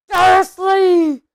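high, booming shout. A narrator's reading. Hagrid, about to explode, calls Uncle Vernon, booming. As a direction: roar the name out in fury. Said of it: "he boomed".

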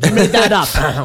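laughing. Real, not acted. Laughing accusation at co-host.

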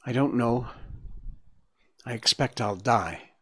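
understated, matter-of-fact answer; said simply. A narrator's reading. Gobo telling Bambi he expects death, said simply. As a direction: state it simply, sinking a little. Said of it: "said simply".

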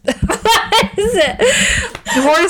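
laughing while searching for the word; giggle, slowly fumbling for it. Real, not acted. Teller forgets the word for hooves, laughing and slow.

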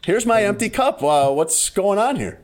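high, slow incredulous complaint; pointed confusion; mock indignant.

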